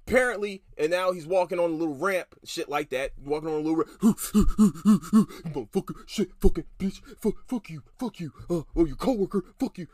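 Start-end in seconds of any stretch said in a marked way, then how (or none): 4.0-5.5 mock gasp. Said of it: "I'm sitting here like". 5.5-9.9 mocking outburst. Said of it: "mock outburst".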